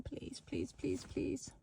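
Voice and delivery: unvoiced, pleading whisper. Real, not acted. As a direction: breathe the pleas. Begging it to be open.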